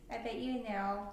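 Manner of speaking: usual speaking voice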